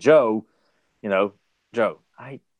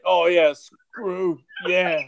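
high, drawn-out mock moan of pleasure; teasing take on a friend in bed; mock lovemaking talk; 5 semitones higher, much slower than usual. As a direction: stretch it out slowly, hamming up the passion. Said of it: "that's what he would say".

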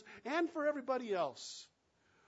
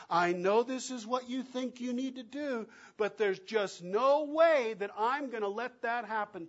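louder, higher, insistent; firm refusal; 5 semitones higher, 7 dB louder than usual. Acted. Peter telling Jesus he won't allow it, insistent. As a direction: refuse to let it happen.

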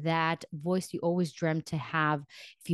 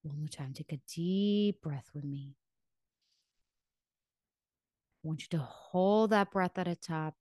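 guided meditation. Acted. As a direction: ease them gently into stillness. Leads breathing in guided meditation.

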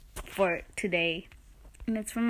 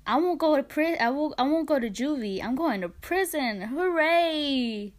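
higher singsong mock rejoicing; sarcastic glee; 5 semitones higher than usual. Teen mocks friend's prison message, sarcastic glee. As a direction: celebrate with put-on glee, stretching the cheer.

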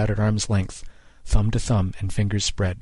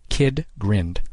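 usual speaking voice

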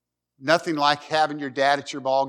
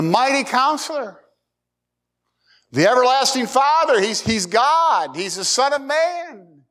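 full-force preaching; 11 semitones higher than usual. Real, not acted. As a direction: ring out the titles of Christ. Preaching peak on Christ's titles.

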